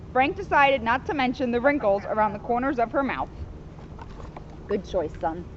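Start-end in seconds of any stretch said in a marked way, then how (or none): none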